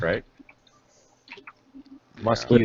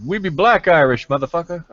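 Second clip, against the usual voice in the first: higher, hammed-up Irish-American tough guy; mock American Irish; 6 semitones higher than usual. Friend mocking how Americans do Irish, hammed-up. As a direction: play the fake Irish gangster. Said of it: "So that's better than".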